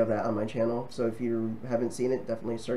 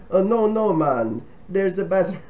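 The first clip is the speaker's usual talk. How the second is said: higher, louder, a bad Jamaican accent; 7 semitones higher, 6 dB louder than usual. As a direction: imitate a local in a clumsy Jamaican accent. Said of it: "That's my bad Jamaican accent".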